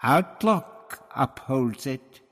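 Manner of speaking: slow, weighted threat; menacing. A narrator's reading. High priest answers Barbara's refusal, menacing. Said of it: "Tlotoxl's voice was a menace".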